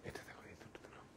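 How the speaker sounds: fast unvoiced whisper to the helper; whispered aside. Real, not acted. Telling the assistant which two sarees to show, whispered fast. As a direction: hiss it off-mic so viewers don't hear.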